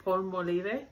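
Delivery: "Palmolive" as pal-mo-LEE-vay, mock Spanish; friend's mispronunciation. Vlogger quoting the friend who cracks her up, "Palmolive" as pal-mo-LEE-vay. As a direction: stretch "Palmolive" to four syllables with a "-vay" ending. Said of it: "every time that she says".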